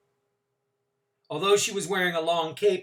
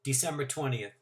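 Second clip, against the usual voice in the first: ordinary delivery, nothing marked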